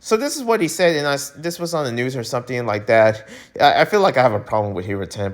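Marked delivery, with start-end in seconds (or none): 3.6-5.3 rushed, low, under his breath; mumbled admission. Pretend. Vlogger mumbling a confession to viewers, rushed and low. Admits problem quietly then loudly.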